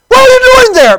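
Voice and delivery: high, full-force acted yell; shouted question. Driver yelling at a stranger in the woods, shouted. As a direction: holler it across the distance. Driver yells from roofless car.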